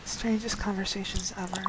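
whispered aside; whispering. Real, not acted. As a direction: whisper it under your breath. Muttered aside while recording.

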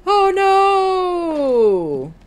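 high, loud shriek. Real, not acted. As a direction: shriek as you fall. Falling in the game.

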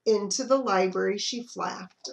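usual speaking voice

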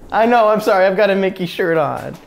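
ordinary delivery

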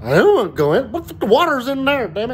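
dog complaining about going inside, whiny; dog's voice. Retold or imitated. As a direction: whine like the dog refusing to come in. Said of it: "Dog's like".

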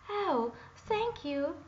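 high, slow, thrilled thank-you; delighted princess exclaim. A narrator's reading. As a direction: gasp it out like a girl who got her toy back. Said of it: "exclaimed the princess".

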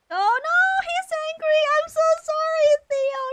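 high, loud frantic sorry; panicked apology. Real, not acted. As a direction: blurt it out in a panic, voice shooting up. Panics at angry game character.

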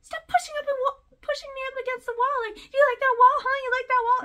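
very high, loud taunting squeal; mock victim voice. Mocking the wife taunting her husband, very high loud squeal. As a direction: sneer it in a put-on shrill voice. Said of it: "And like".

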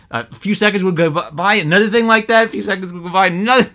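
high, loud, worked-up repetition; exasperated rant. Real, not acted. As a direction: build to a high, loud pitch of frustration. Rant about the reader builds up.

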